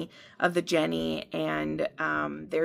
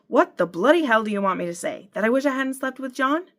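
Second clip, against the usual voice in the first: rising angry outburst, higher and louder; losing her temper; 7 semitones higher, 4 dB louder, faster than usual. Acted. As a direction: snap back, temper finally gone. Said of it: "I lost the temper I'd been holding".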